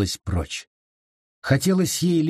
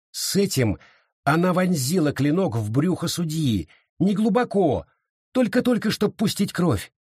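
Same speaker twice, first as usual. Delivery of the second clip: ordinary delivery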